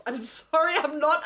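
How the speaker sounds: ordinary talk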